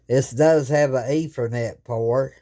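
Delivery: ordinary delivery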